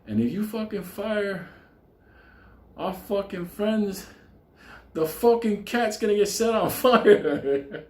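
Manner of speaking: slow threat. Retold or imitated. Benny threatening the boss about the cat, slow mock menace. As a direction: spell out the threat word by word. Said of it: "he tells the guy".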